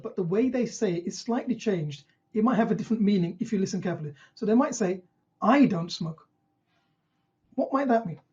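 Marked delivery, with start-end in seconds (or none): 5.4-6.3 "I" drawn out and leaned on; stress on I. Shown as a demonstration. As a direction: lean hard on the word "I". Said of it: "the emphasis on the I".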